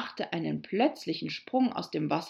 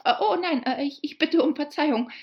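high, loud stammered apology; flustered cry; 9 semitones higher, 6 dB louder, faster than usual. A narrator's reading. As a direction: catch the blunder and stumble into apology. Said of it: "rief Alice wieder". (erschrocken stammelnde, laute Entschuldigung)

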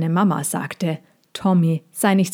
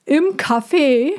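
higher, slow dove voice; the fat dove adds its wish, higher and slow; 7 semitones higher, slower than usual. A narrator's reading. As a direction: coo it slowly like a plump pigeon. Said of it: "fügte die dickere Taube an".

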